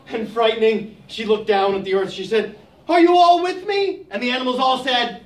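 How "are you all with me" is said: calling down. Acted. Scared giraffe calls down.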